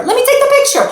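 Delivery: fussing mom losing patience; frantic repeat. Retold or imitated. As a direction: push the plea up a notch. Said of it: "and you say to the kid".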